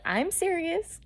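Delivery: high, soft, earnest imitation; imitated doctor insisting. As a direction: lift it high and light to imitate his reassurance. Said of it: "kinda like that".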